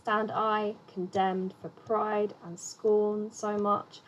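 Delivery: slow, even beat on "I", "demned", "pride", "scorn", "much"; measured verse rhythm, weight on every second syllable up to "much"; iambic scansion. Shown as a demonstration. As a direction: read "Stand I condemned" foot by foot, unhurried. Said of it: "quite a plain example of it".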